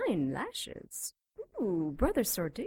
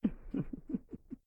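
breathy mocking laugh; 5 semitones higher, 5 dB quieter than usual. Demon mocks the cleric.

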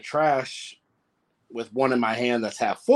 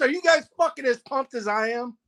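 excited; 12 semitones higher than usual. Real, not acted. Guest hyped for game day.

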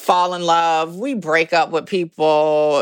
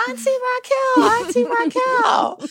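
put-on high squealing child voice; squealing kids. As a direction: squeal it like an excited little kid. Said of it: "nieces and nephews who love to squeal my name".

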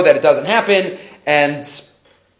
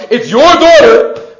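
loud, slow, higher-pitched blame; mad parent; 5 semitones higher, 7 dB louder, slower than usual. Acted. Mad parent to the other spouse about their child, loudly blaming. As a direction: act out an exasperated parent passing the blame. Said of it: "gets mad at a child".

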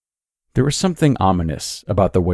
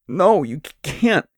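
tearful moan; 8 semitones higher, slower than usual. A narrator's reading. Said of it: "Holly moaned".

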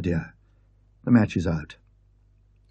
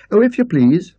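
put-on lightly raised, eager young maid; a bright housemaid offering help, a little louder; young maid asking the guest politely; 10 semitones higher, 7 dB louder than usual. Maid voiced by the narrator.

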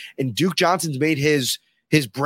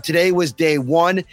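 ordinary delivery

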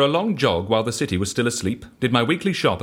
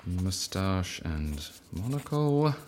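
softer and slow, saying it as he writes; murmured note-taking; 3 semitones lower, 7 dB quieter, much slower than usual. Acted. Writing down the other man's wish, quiet slow murmur. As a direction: mutter it to yourself while jotting it down. Repeats the item while writing it down.

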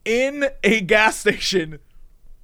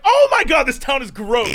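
disgusted; 5 semitones higher than usual. Retold or imitated. Narrator in line, disgusted by the town, shrieks. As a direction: recoil at the whole town.

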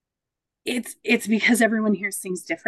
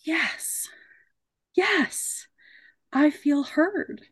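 hushed, high-pitched yes of relief; relieved at being heard; 7 semitones higher, much slower than usual. Acted. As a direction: breathe out in relief, slowing down. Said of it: "just ready to lose it to".

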